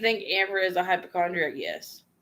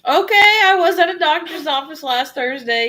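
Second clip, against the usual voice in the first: high, loud startled reply; surprised streamer answering a viewer who saw her, high and loud; 9 semitones higher, 8 dB louder than usual. Real, not acted. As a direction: blurt it out, caught off guard. Reacting to viewer sighting her.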